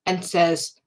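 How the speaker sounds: normal talking voice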